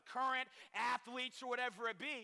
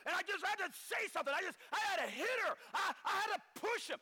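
fast, higher, pleading self-justification; mock excuse; 7 semitones higher, faster than usual. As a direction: rush out the excuses. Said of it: "They say".

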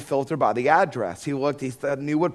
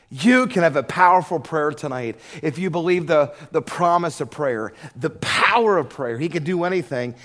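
raised, louder preaching voice; 4 semitones higher, 5 dB louder than usual. Acted. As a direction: preach it with fervor to the crowd. Preaching at full force.

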